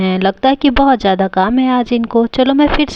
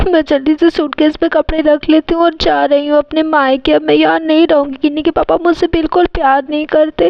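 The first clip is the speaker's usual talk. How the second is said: mildly tearful, slightly raised voice; acted tearful hurt; 4 semitones higher than usual. Wife threatening to leave home, tearful hurt. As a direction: complain through put-on tears. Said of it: "mummy कितना ज़्यादा रो रही है".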